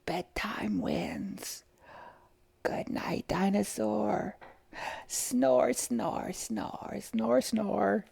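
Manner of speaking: slow, hushed whisper fading to snores. A narrator's reading. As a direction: whisper goodnight to a sleeping dinosaur. Bedtime, dinosaur falls asleep.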